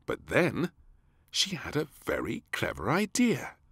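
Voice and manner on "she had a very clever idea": usual speaking voice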